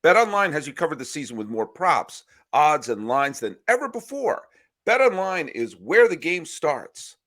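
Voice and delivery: upbeat sponsor read. Acted. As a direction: read it like a podcast ad.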